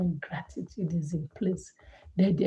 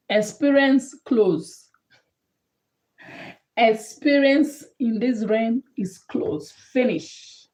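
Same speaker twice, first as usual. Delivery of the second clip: loud and slow, higher pitched; emphatic declaration; 4 semitones higher, 6 dB louder, slower than usual. Real, not acted. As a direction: hammer each word home slowly. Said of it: "preacher declares with force".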